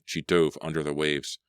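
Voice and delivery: ordinary delivery, nothing marked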